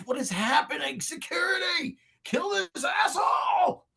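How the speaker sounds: high, frantic shouting for help; panicked yelling. Acted. Villain calls security on his attacker, high frantic shout. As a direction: scream orders with rising panic.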